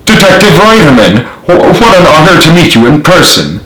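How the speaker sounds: feigned happiness. A narrator's reading. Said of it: "tried to feign happiness and relief".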